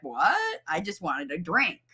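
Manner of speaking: lightly voiced toddler; puzzled toddler. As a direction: play the baffled little boy. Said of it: "he's gonna be like".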